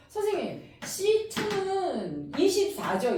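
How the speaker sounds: high, slow questioning student; imitating a student. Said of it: "이러는 거야".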